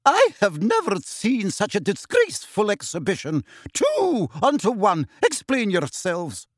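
high, indignant reprimand, slowing at the end; quivering rage. A narrator's reading. Professor confronting two pupils over their fight, quivering with rage. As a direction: pitch it up and demand an answer. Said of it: "quivering with rage".

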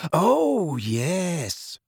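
put-on dreamy, drawn-out girl's voice, swooping high then falling; airy, unhurried delight; Luna. A narrator's reading.

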